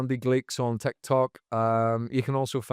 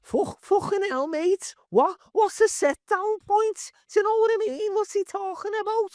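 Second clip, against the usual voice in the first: put-on butchered, much higher Liverpudlian accent. Comedian egged on by host, mangling a high Liverpudlian accent. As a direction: ham up a Liverpool bus driver's Scouse accent, pitched way up. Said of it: "I can't do the Liverpudlian accent".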